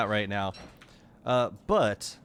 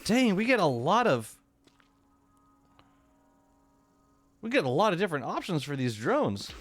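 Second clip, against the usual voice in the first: excited planning; 6 semitones higher than usual. Real, not acted. Excited about drone options.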